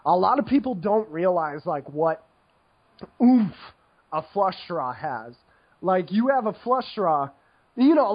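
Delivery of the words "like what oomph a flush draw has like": ordinary talk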